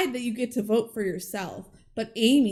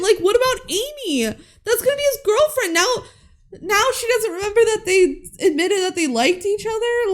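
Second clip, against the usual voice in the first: laughing; 10 semitones higher, 4 dB louder than usual. Real, not acted. Laughing protest.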